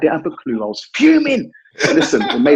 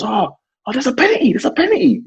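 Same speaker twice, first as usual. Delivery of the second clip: high mocking sing-song of a rival fan; mock taunting; 9 semitones higher than usual. Mate on the phone taunting him, high mock gloat. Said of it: "And he goes".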